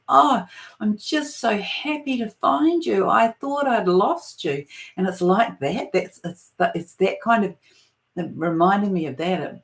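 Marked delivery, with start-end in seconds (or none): 0.1-4.6 retold tearful relief. Mother's relieved tearful reply retold.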